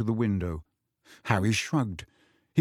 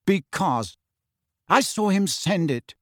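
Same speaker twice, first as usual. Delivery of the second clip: angry; 6 semitones higher than usual. A narrator's reading. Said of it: "said Cho angrily".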